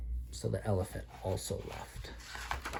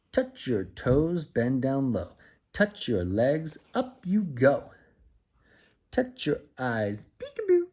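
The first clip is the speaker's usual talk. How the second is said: put-on slow, sing-song rhyme to a child; slow playful nursery chant; action rhyme; 4 semitones higher, 6 dB louder, slower than usual. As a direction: lead the little one through the motions.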